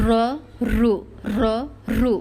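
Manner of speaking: র, রু with a strongly trilled R; heavy R. Shown as a demonstration. Teacher showing how thick R sounds, heavy trilled র, রু. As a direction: roll the tongue hard on র and রু. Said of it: "মোটা রটা কী রকম উচ্চারণ হয়".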